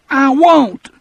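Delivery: put-on high, loud childish refusal. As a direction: dig in like a scared child. Percy refuses again.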